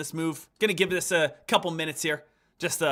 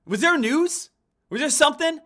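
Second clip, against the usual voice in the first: loud, much higher, baffled; incredulous questions; 10 semitones higher, 6 dB louder than usual. Real, not acted. Trader wondering aloud about the drop, high and incredulous. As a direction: ask it high and loud in disbelief.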